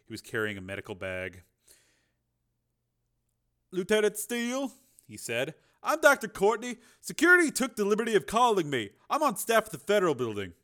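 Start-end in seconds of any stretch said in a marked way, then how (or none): 3.7-4.7 high-pitched doctor voice; doctor character. A narrator's reading. Doctor figure in a narrated story. 5.8-10.5 high, brisk doctor voice; raised, businesslike character voice; doctor introducing himself. A narrator's reading. Doctor figure in a narrated story.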